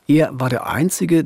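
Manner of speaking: normal talking voice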